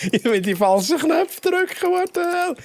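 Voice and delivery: high, laughing disbelief. Real, not acted. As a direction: laugh through the frustration. Laughing at own mistakes. (lachend ungläubig)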